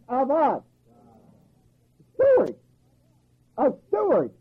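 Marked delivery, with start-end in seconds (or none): 2.2-2.6 Stewards shouted high and loud; emphatic Stewards. Real, not acted. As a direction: slam the word Stewards up high. Preacher hammering the key word.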